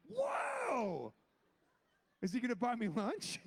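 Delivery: high, joyful whoa then a cheeky question; thrilled. Retold or imitated. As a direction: shout of delight, then tease. Getting the call, he's cast.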